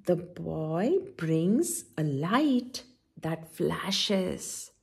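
slow, drawn-out storybook reading; reading to children. Acted. Auntie D reads Clifford aloud to the children, slow storybook voice. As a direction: read each line slowly, as if to little kids.

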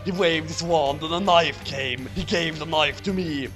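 usual speaking voice

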